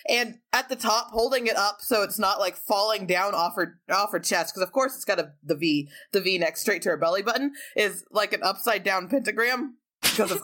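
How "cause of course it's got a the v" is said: ordinary talk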